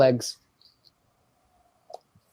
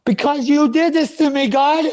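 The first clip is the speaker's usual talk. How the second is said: high, raised, pained plea to God; anguished cry; 8 semitones higher than usual. Real, not acted.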